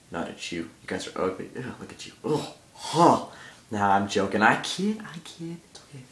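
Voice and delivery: usual speaking voice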